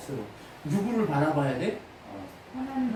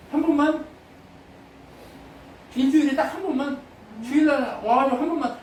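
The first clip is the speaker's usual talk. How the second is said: louder, higher preaching push; full-force preaching; 7 semitones higher, 5 dB louder than usual. Acted. Pastor rebuking the congregation, loud higher preaching. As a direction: drive the point home to the congregation.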